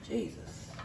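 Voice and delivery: breathless whispered "Jesus" at the burnt meat; whispered dismay. Real, not acted. Cook seeing the burnt steak, whispered dismay. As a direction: let it slip out under your breath.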